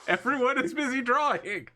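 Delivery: high, stretched-out complaint; exasperated outburst. Real, not acted. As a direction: voice leaps up in comic exasperation. Mock-exasperated complaint at players.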